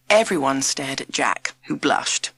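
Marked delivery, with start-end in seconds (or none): none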